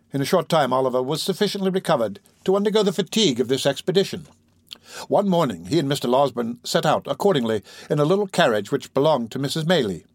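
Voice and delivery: plain reading voice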